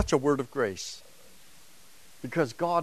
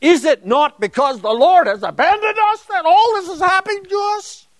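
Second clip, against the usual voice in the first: loud, high-pitched outcry; emphatic rhetorical question; 10 dB louder than usual. Shown as a demonstration. Lecturer voicing the people's accusation against God, emphatic outcry. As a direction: fling it out as an indignant accusation. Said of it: "It's a rhetorical question expecting an emphatic positive answer".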